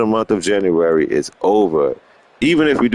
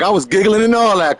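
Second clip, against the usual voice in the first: mock viewer voice; 8 semitones higher than usual. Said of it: "He was like".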